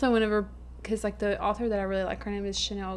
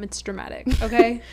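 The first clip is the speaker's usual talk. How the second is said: dramatic; 3 semitones higher, 7 dB louder than usual. Pretend. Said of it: "if there's one thing I am".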